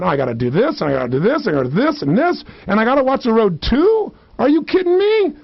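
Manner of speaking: acted mounting exasperation; overwhelmed beginner. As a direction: pile up the tasks until the voice shoots up. Acted panic of a beginner driver.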